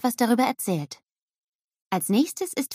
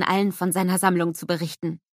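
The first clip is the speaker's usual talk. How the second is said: usual speaking voice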